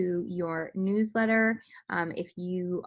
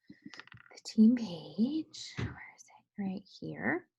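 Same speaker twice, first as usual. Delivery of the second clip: slow whisper while searching; 4 dB quieter, much slower than usual. Real, not acted. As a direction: whisper it to yourself.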